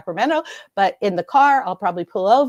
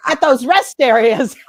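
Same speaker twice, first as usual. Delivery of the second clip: laughing; 4 semitones higher, 9 dB louder, slower than usual. Real, not acted. Laughing denial.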